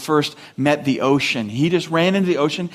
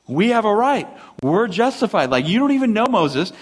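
higher-pitched mock indignation; defiant protest; 6 semitones higher than usual. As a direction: push back, insisting on your rights. The people push back at Moses.

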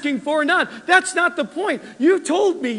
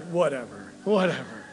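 low, quiet mumbled whatever; dismissive son; 11 semitones lower, 7 dB quieter than usual. Retold or imitated. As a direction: brush it off like a bored grown son. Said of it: "And he's like".

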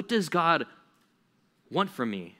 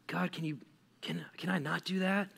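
soft, halting, tentative plea; timid request; 4 semitones higher, 7 dB quieter than usual. Acted. Reluctant person asking God to be excused, timid. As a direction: ask hesitantly, stumbling over the start. Individual timidly questioning God's command.

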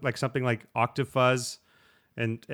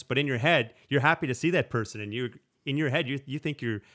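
ordinary talk, nothing marked

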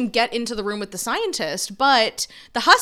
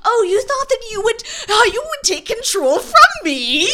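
hammed-up evil villain; 10 semitones higher, 5 dB louder than usual. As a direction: ham up the evil tyrant. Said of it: "he's like".

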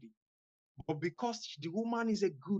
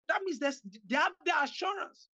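preaching shout; 8 semitones higher than usual. Real, not acted. Preacher shouting the promise of assurance to the listeners. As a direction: shout it out to the congregation.

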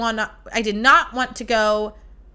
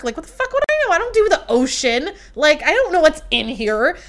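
panicked outburst; 7 semitones higher, 4 dB louder than usual. Real, not acted. Panicked retelling of sting.

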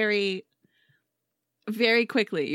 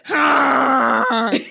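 loud burst of laughter; 4 semitones higher, 7 dB louder than usual. Real, not acted. Burst after camel noise.

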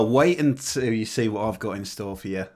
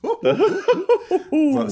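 nervous laugh; 8 dB louder, much slower than usual. Real, not acted. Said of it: "the nervous laugh".

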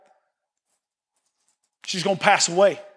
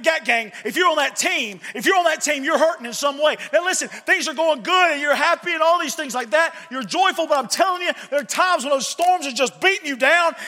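higher, driving preacher build; fervent preaching; 6 semitones higher than usual. Acted. Preacher urging the church about hard times, fervent preaching. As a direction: preach it up, rising with fire.

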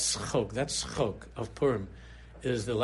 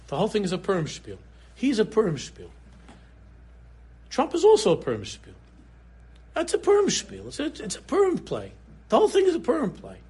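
fervent preaching; 8 semitones higher, 4 dB louder than usual. Acted. Preaching Purim spiel refrain.

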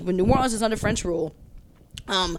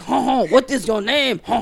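put-on French accent; 2 semitones higher, 5 dB louder than usual. Census man asking immigrants, hammed-up French accent. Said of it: "Is this your French accent".

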